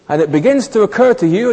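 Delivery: ordinary talk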